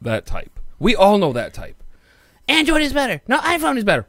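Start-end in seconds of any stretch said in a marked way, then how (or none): 2.5-4.1 high, whiny arguing voice; mocking fanboy voice. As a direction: mimic a petty phone snob.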